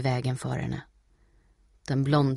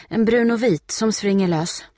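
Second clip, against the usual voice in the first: higher, louder explanation; urgent description; 6 semitones higher, 7 dB louder than usual. A narrator's reading. Steffi describing lost Putte to boys, urgent. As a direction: press them for help.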